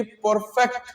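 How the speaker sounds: normal talking voice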